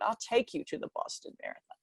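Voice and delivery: soft whispered secret; whispered promise. Retold or imitated. Coach's quiet promise.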